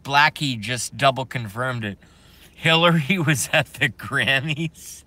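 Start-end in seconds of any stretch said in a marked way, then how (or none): 2.5-5.0 laughing disbelief. Real, not acted. Streamer told the news is confirmed, laughing incredulously. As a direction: laugh breaking into the question.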